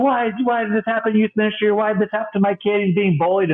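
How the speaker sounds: put-on an upset parent complaining about their kid; mock angry parent. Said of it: "Sometimes you get an angry parent".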